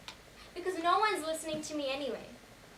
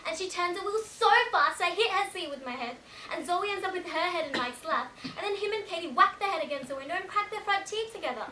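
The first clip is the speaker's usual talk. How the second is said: higher, louder, tumbling account; agitated retelling; 5 semitones higher, 7 dB louder than usual. Acted. Passenger recounting the sudden swerve, agitated and raised. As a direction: tell it with rising alarm, pitched up.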